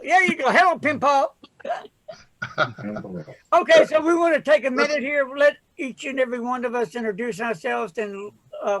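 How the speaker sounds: ordinary talk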